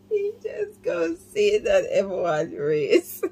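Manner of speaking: laughing so hard it breaks into crying; can barely get words out between laughs; real tearful laughing fit; helpless laughter. Real, not acted. Reactor laughing through all lines.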